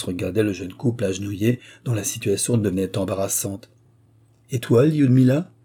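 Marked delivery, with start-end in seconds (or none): 4.5-5.5 raised, louder stern question. A narrator's reading. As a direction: challenge her like a stern father. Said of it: "fit-il d'un ton sévère". (ton sévère, plus haut et plus fort)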